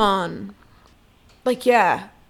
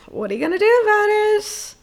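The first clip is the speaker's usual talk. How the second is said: high cheeky challenge; defiant taunt; 12 semitones higher, 5 dB louder than usual. Real, not acted. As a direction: dare him, louder and playful. Taunting her former boss.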